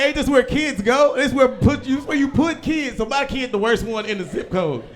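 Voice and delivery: high, disbelieving complaint; indignant parent. Retold or imitated. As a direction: protest it to the principal. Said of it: "You be like".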